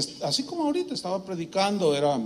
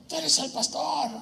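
higher challenging tough guy; mock tough guy; 9 semitones higher than usual. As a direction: square up to him, hammed up. Angry man confronts pastor. (matón desafiante, imitado y más agudo)

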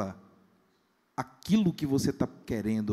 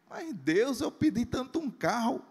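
high, whiny complaint; mock disappointment; 10 semitones higher than usual. Pastor imitating a man let down by an old Fusca, whiny. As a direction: whine to God.

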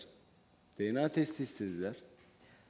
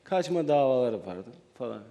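louder, projected into the microphone; speaking up; 5 dB louder than usual. Real, not acted. Guest repeating for the host on request, speaking up. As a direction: raise your voice so the host hears. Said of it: "Biraz bağırırsan Recep böyle".

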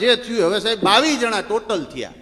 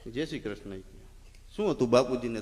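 hushed condolence; 6 semitones lower, 7 dB quieter than usual. Retold or imitated.

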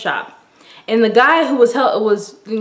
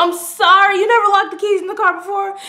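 high, fast sarcastic retort; mocking comeback to the boyfriend, higher and quicker; sassy comeback; 9 semitones higher than usual. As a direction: fire it back with attitude. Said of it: "I was like".

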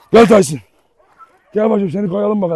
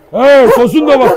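startled shout; 6 semitones higher, 6 dB louder, slower than usual. Real, not acted. Second dog attacks.

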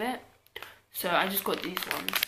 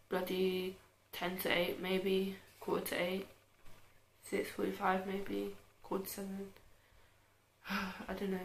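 ordinary talk, nothing marked

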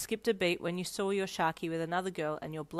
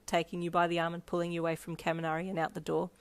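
ordinary delivery, nothing marked

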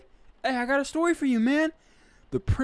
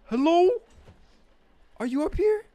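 high call up the stairs; 10 semitones higher, 5 dB louder than usual. Real, not acted. Looking upstairs for the baby, calling out high.

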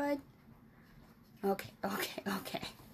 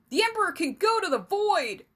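much higher, louder angry shout; yelled; 10 semitones higher, 8 dB louder, faster than usual. Acted. Rebel in the crowd yelling at the emissary, defiant shout. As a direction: hurl it from the back of the mob. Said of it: "someone yelled from the crowd".

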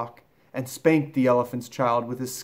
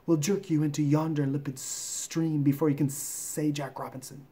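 python rock snake; 3 semitones higher than usual. Acted. Python warning the elephant child about the crocodile, snaky. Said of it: "This is the way bicolored python rock snakes always talk".

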